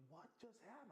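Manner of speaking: put-on high-pitched bewildered girl voice; high mock distress; imitating shocked girl. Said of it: "She goes".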